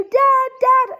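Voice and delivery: high, loud distressed call for Dad; retold sobbing cry. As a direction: act out crying out to your dad. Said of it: "sobbing, like, I'm crying".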